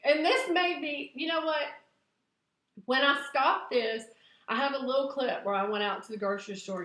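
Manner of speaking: ordinary talk, nothing marked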